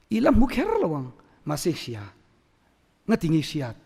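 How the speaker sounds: ordinary talk, nothing marked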